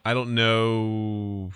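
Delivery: very slow, trailing stall; long, held-out hesitation; drawn-out uncertainty. Real, not acted. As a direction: stretch the last word right out. Stalling as the banter runs out.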